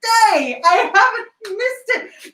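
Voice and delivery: very high and drawn out, slow with a sob of joy; tearful, joyful exclamation; ecstatic realisation. Acted. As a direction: cry it out in disbelief. Scrooge's overjoyed realisation.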